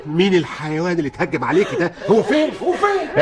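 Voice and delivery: high, rising furious demand; enraged father. Acted. Father furious at the man who attacked his daughter. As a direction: wants the attacker now.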